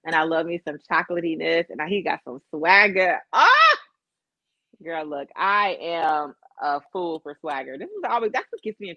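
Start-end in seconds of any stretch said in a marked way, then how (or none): 3.3-3.8 loud, high squeal of delight; excited squeal. Real, not acted. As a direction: squeal like a fan. Gushing over his swagger.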